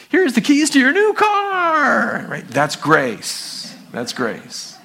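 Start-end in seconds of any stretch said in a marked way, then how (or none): 0.1-2.3 high, loud, drawn-out giveaway shout; excited announcement. Acted. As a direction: announce a big prize. Game-show style gift announcement.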